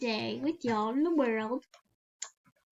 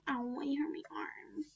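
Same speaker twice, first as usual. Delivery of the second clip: fast mock whisper; 8 dB quieter, faster than usual. Playing along with avatar.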